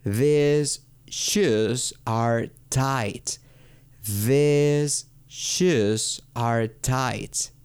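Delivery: very slow words, THESE held on a long ee; exaggerated long-vowel model. Shown as a demonstration. Teacher contrasting plural for learners, slow drawn-out THESE. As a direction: space out each word and stretch "These". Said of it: "lo digo como con doble I en español".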